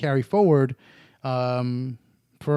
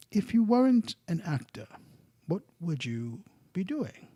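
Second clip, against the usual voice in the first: higher, slow interviewer voice, softer at the end; lightly mocking the talk-show host's question; imitating a grave interviewer, drawing it out; James Lipton impression; 3 semitones higher, 6 dB quieter than usual. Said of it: "he goes like this".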